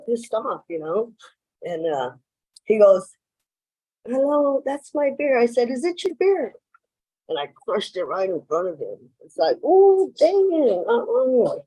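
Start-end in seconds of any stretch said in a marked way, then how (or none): 4.1-5.4 high, fed-up "Hello" from the stranger; losing patience, pitched up; exasperated stranger. Retold or imitated. Man at the bar, fed up, demands his beer back. 5.7-6.5 high, sneering question; mocking taunt. Speaker taunts the man at the bar, sneering. 9.6-11.6 slow, high mock "oh dang it"; mock dismay. Speaker mocks the man after crushing his beer, slow.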